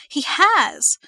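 sudden loud high outburst; loud indignant cry; burst out. A narrator's reading. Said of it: "Verity burst out".

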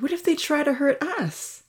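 high, anxious question; fearful arguing. A narrator's reading. Dia fears the boys will hurt them, anxiously arguing. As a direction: protest with worry, pitched well up. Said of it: "Dia argued".

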